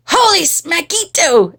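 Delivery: high, punchy exclamation; exclaiming kitty boy. A narrator's reading. Kitty boy tasting a fly, high exclamation. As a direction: burst out as an excited kitten. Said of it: "exclaimed the kitty boy".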